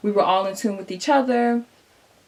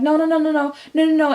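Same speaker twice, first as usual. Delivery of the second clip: scared; 6 semitones higher, 3 dB louder, faster than usual. Retold or imitated. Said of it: "super scared at this point".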